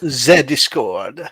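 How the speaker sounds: slow, drawn-out French-style "ze Discord"; mock French accent. Host trading words with co-host, slow mock French accent. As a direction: stretch it in a put-on French accent. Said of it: "we have gone French".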